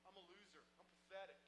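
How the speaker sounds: soft, defeated inner voice; quiet self-contempt. Retold or imitated. Marine accepting his commander's verdict, quiet defeated self-contempt.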